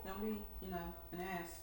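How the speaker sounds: normal talking voice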